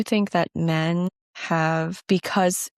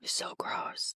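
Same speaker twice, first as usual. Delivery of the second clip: very soft, whispered aside; hushed disgust; 13 dB quieter than usual. Real, not acted. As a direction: whisper it under the other person. Disgusted aside under co-host's talk.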